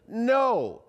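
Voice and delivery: high, firm, emphatic refusal; enthusiastic. Shown as a demonstration. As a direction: say it like you mean it. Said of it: "with enthusiasm".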